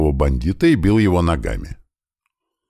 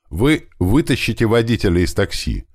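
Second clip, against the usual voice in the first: shouted order; 6 semitones higher than usual. Acted. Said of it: "крикнул я мужчине".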